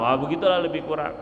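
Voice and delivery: usual speaking voice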